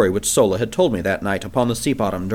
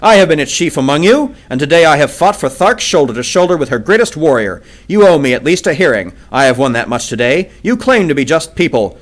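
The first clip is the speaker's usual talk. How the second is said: loud, raised appeal; impassioned appeal; 4 semitones higher, 6 dB louder than usual. A narrator's reading. John Carter pleads loudly before the council. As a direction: demand a hearing with force. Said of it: "I cried".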